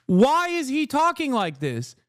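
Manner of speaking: ordinary talk, nothing marked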